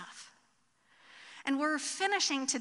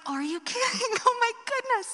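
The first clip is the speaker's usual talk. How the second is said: laughing disbelief; 10 semitones higher, 6 dB louder than usual. Retold or imitated. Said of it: "And I was like".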